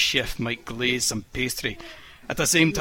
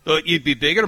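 pouting; 3 semitones higher, 5 dB louder than usual. A narrator's reading. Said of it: "Mary pouted".

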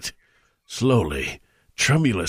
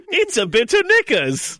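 high, far-off squeaky outburst; shrill cartoon exclamation; exploding; 11 semitones higher than usual. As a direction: burst out in a high silly voice. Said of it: "he exploded".

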